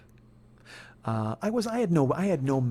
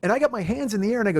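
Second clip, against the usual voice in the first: normal talking voice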